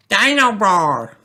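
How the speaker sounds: put-on high, slow toddler squeal; little child thrilled by a new toy; excited. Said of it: "says George excitedly".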